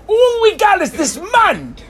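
high, drawn-out whine of the fed-up; mock indignant griping; exasperated complaint. Acting out the people's complaint.

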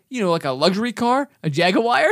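"Jaguar" ending in wire, rising questioningly; imitated mispronunciation. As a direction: mimic him insisting on jag-uh-wire. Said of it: "And he's like".

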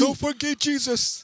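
high, squeezed put-on fighter voice; Yoel Romero impression. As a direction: bark it like the old fighter. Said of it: "imitates Romero after S0".

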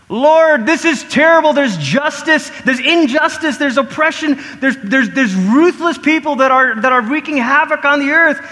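high, loud cry to the Lord; hammed-up anguished appeal; desperate plea. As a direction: plead urgently over injustice. Acted desperate prayer about injustice.

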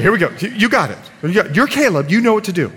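ordinary talk, nothing marked

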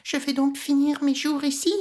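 put-on high, plaintive child's question; a boy realising he is trapped; dismayed boy. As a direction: Icare despairing against the wall, high boyish voice. Icare despairs.

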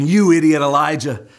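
cocky Baal prophet scoffing at Elijah; mocking taunt. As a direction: sneer at the prophet like a bully. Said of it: "The prophets of Baal were thinking".

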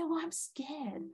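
very soft, slow admission of fear; a frightened child confessing quietly; small scared voice. Pretend. Inner child admits to the adult it is scared, slow and hushed. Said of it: "They say".

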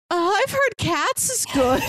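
mock neighbor. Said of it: "the neighbor's like".

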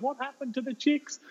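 high-pitched imitated children; eager kids. Pupils to teacher the next day, eager kid voices. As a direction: ask it high like curious pupils. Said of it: "the kids next day".

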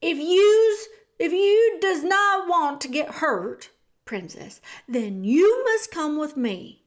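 loud high shouted threat; loud rote shout of a rehearsed line. Acted. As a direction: shout it out with eyes shut. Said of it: "shouted her piece".

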